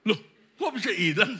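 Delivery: high, loud mock disbelief; astonished. Father hearing the bag is lost, astonished. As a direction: blurt it out, amused and incredulous.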